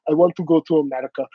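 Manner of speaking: quick put-on Iranian accent; hurried mock Iranian accent; imitated Iranian accent. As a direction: mimic an eager Iranian speaker.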